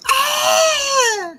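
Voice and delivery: piercingly high, loud yell; loud high scream. Real, not acted. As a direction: scream suddenly at the screen. Yells at the screen.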